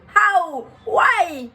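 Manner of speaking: loud, high cry of disbelief; high, incredulous retold outburst; mock disbelief. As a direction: wail it in hammed-up outrage. Acting out shock at ejection.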